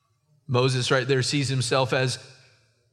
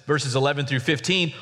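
ordinary talk

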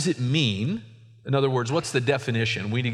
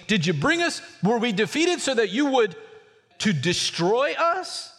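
questioning Joshua; 9 semitones higher than usual. Acted.

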